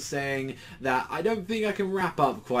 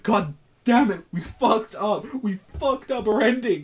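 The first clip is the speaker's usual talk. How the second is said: high, loud wail of regret; despairing Katsura; 10 semitones higher, 6 dB louder than usual. Retold or imitated. Katsura lamenting the ruined ending, high loud wail. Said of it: "Katsu's like".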